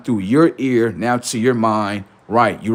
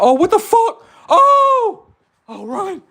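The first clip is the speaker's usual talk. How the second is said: much higher, hammed-up panicked yelps with a laugh; mock panic; 4 dB louder, slower than usual. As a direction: play terrified people fleeing the talking sun. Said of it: "people gonna be like".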